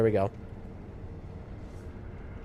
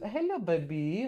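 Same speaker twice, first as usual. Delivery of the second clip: cooing to cat; 3 semitones higher, 12 dB quieter, slower than usual. Real, not acted. Streamer greets his cat, soft slow cooing. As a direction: coo hello to the cat, much softer.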